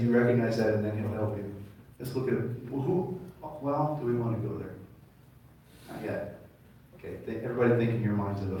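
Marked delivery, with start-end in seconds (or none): none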